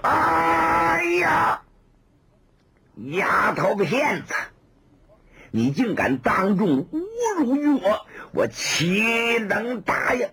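high furious cry, then slow menacing words; outraged shout. Acted. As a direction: explode with wounded pride, then threaten slowly. Insulted swordsman erupting.

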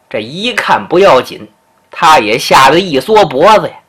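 ordinary delivery, nothing marked

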